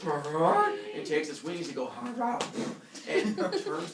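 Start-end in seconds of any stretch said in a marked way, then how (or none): 0.0-0.7 loud imitated swan honk; swan cry. Swan before taking wing, loud imitated cry. 2.0-2.4 high-pitched imitated swan honk; swan cry. Swan taking wing, high imitated cry.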